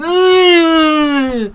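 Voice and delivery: high, loud politician grunt; a hammed-up politician drawl; politician impression. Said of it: "my typical politician impression".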